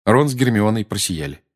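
ordinary delivery

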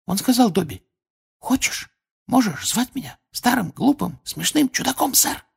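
high, hesitant elf voice; shy little house-elf with halting words; embarrassed Dobby. A narrator's reading. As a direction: confide it bashfully as a squeaky elf. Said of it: "Смущённо шепнул Добби и испуганно хихикнул".

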